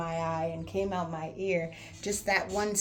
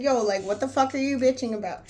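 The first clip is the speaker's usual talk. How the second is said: louder and higher confrontation; yelling; 6 semitones higher, 8 dB louder than usual. Retold or imitated. Squaring up to a sulking friend, loud yell. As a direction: stand up and yell at him. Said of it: "yelling at him or arguing back".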